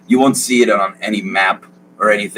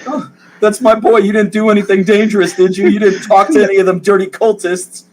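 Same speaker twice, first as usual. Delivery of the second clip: high fussing father; anxious father voice, much higher; worried doting dad; 13 semitones higher, 4 dB louder than usual. Acted. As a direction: fuss over your son's safety. GM plays the father.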